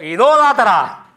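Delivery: loud, slow shout with a laugh; shouting "idólatra" from the church door; shouted accusation. Retold or imitated. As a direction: yell it at the kneeling crowd, half laughing. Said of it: "gritó".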